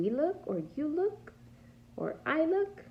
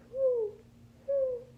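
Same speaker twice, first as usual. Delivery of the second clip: high, loud hooting like an owl; 6 dB louder than usual. Acted. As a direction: hoot twice like an owl in the dark woods. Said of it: "Pete hears a strange".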